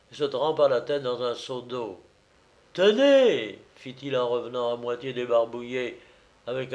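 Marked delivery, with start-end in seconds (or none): 2.7-3.6 high, loud "Tenez"; triumphant call. Acted. Dumolay returning half washed, high loud call. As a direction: call out to show the proof. Washed man proves his identity.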